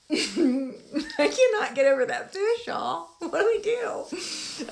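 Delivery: high, loud exasperation; can't let it go; upset. Real, not acted. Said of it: "now I'm getting upset".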